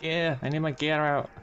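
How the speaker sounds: terrified gibbering. Real, not acted. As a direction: beg the plane, frantic. Gear stuck, crash looming.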